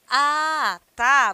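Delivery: loud "Ah, tá" with a sudden lift, as if just realizing; surprised "ah, tá", said out loud; "ah, tá" of surprise. Shown as a demonstration. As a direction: exclaim "Ah, tá" like something finally clicked. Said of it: "se você quer dizer assim".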